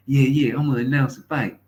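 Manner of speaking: lightly voiced cocky boxer; hammed-up boast; mock boxer. Imitating boxer.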